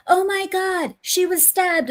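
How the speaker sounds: high, hammed-up horror; mocking shocked cry, pitched well up; mock horrified. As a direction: play the screaming bystander for laughs. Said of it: "they go".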